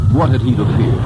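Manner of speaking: ordinary delivery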